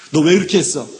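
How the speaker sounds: loud, demanding voice of God; God's stern question. Retold or imitated. God confronting a person about their sin, loud stern demand. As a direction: call the sinner to account.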